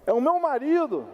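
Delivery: high-pitched wife standing up for her husband; mock a wife's proud protest; imitating indignant wife. (imitando a esposa indignada, em voz aguda)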